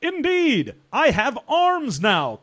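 high, slow mechanical character; chirpy high robot with new arms. Acted. Robot character answers in role.

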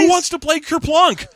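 high-pitched mock delight; excited soldier. Acting soldiers delighted by the game.

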